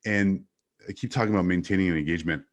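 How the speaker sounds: ordinary delivery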